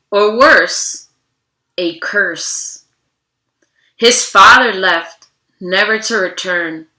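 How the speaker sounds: steady storybook narration, slowing on key moments; reading to children. Acted. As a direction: read the story aloud to a class. Reads picture book to children.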